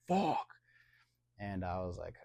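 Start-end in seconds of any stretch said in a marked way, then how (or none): 0.1-0.5 mock yell. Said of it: "They're like".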